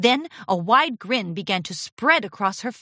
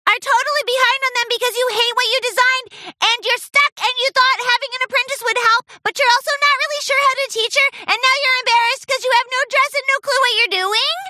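very high, loud, rapid-fire chirp; hyperactive squeaky motormouth; breathless rattle; 6 dB louder, faster than usual. A narrator's reading. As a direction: race through it all on one deep breath. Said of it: "Pinkie Pie took a deep breath".

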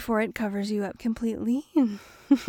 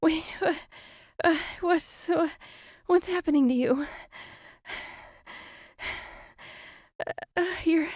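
panicked victim; 4 semitones higher, 9 dB louder, faster than usual. Acted. Victim paralyzed, facing doppelganger.

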